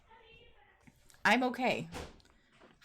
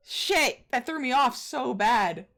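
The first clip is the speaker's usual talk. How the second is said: ordinary talk, nothing marked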